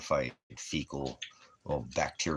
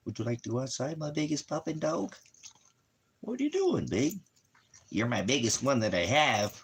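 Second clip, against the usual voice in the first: talking to the dog; 5 semitones higher than usual. Real, not acted.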